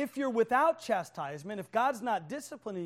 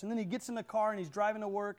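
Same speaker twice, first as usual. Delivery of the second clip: ordinary talk, nothing marked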